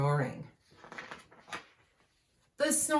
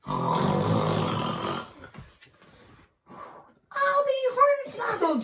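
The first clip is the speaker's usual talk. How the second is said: hammed-up astonished shout; astonished cry; 4 dB louder, much slower than usual. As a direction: cry out in amazement. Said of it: "Jack Huffle cried".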